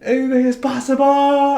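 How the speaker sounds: very high, loud mock victory yell; imitated sky-high yell. Host mimicking Kevin Garnett's famous moment, imitated yell into the sky. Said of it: "yelling into the sky".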